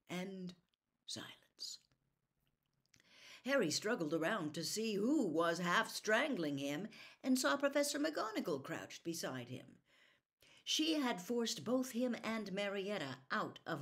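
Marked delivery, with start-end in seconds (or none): none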